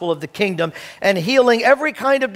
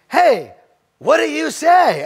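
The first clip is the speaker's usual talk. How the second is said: greeting heard as question; 7 semitones higher, slower than usual. Retold or imitated. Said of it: "they're hearing a question".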